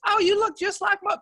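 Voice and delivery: put-on high-pitched Georgian lady's accent. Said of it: "like, Georgian accent".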